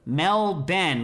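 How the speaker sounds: Melbourne spelt out as MEL-BEN; Melbourne with an emphatic "Ben" ending, no R; rhymes with Ben. Shown as a demonstration. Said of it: "like my brother Ben".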